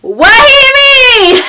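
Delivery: high, loud, stretched-out squeal; drawn-out exaggerated shriek; shocked outburst. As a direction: screech it in mock disbelief. Acts out sister's shocked look.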